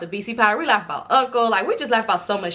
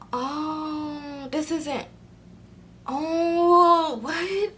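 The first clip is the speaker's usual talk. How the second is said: stunned; 6 semitones higher, 3 dB quieter, much slower than usual. Retold or imitated. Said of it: "I said".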